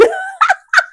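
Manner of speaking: squealing high giggle; tickled laugh at her own surprise; high burst of laughter. Real, not acted. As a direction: break into a delighted high laugh.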